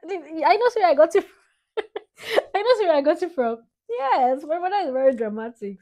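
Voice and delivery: high loud playful claim; excited teasing. Real, not acted. Daughter teasing dad about her dramatic mother, excited. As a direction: tease dad gleefully.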